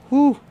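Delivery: very high pained exhale; sharp pained whew. Real, not acted. Streamer scratched by his cat, sharp pained whew. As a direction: hiss out the pain of claws.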